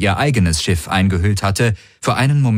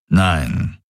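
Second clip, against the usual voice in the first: low grumbled refusal, falling; low grumble; 4 semitones lower than usual. A narrator's reading. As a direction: grumble it low, like a stern commander. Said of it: "brummte Daraios". (tief gebrummt)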